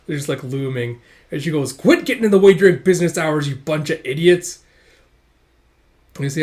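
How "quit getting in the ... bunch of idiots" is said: loud irritated telling-off; angry scolding. Acted. Restaurant owner looming over noisy youngsters, angry scolding. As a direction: chew out troublemakers.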